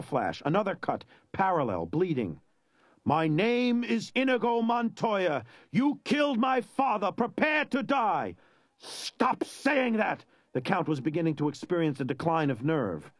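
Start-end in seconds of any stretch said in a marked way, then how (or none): 3.0-8.3 each word a blow, pitched high. Acted. Inigo repeats vow, now wounded. 8.8-10.2 high, slow, strained cry of panic; the Count cracking under fear; panicked plea. Acted. Count Rugen begging Inigo to stop, high panicked cry.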